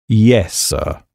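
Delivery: low, measured servant's reply; discreet, low-voiced valet; deferential valet. Valet answering his master in dialogue.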